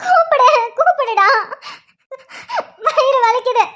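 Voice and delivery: slow, high-pitched tearful wail; crying in pain. Acted. Wife in labour begs husband to call someone, crying in pain. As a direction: wail for help through sobs. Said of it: "அழாத, அழாத".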